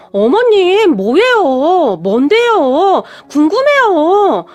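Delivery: eager pestering. Acted. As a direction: drag out each question, begging to know. Pestering mother-in-law, shaking her arm. (시어머니 팔을 흔들며 궁금해 조르는 목소리)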